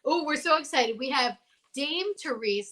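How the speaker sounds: ordinary talk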